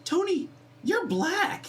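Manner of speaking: put-on high, slow, innocent child voice; little girl voice. Little girl bluntly telling Tony at church, high childlike voice. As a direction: say it wide-eyed like a small curious kid.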